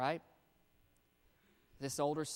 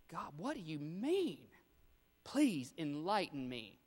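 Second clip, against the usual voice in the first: mock respectful; 3 semitones higher than usual. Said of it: "in the most respectful way".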